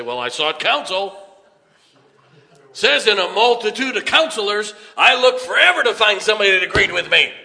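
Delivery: loud, high, hammed-up protest; mock self-justifying churchgoer. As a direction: build to a high, loud, hurried finish. Mocks person shopping for agreeable counsel.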